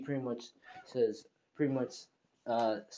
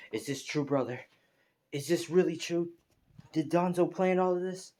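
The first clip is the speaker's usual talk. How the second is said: acted anger climbing in pitch; rising anger; 6 semitones higher than usual. Sasuke questions his brother about Danzo's plan, rising anger. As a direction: start near normal, build to high, mildly angry questioning. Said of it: "getting anger. And he starts saying".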